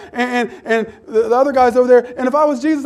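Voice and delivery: ordinary talk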